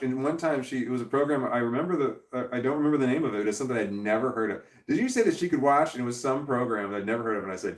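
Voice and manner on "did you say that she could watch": higher, louder accusing question; imitating angry ex. Ex-wife calling the dad about Netflix, mock accusing. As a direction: mimic her shrill outrage, half-mocking. So her mom would call me.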